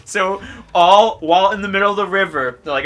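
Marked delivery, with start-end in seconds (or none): none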